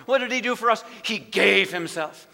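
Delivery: normal talking voice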